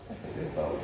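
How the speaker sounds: hushed whisper of praise. Real, not acted. Trainer whispering praise to his dog Bowie. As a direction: whisper it gently to the settled dog.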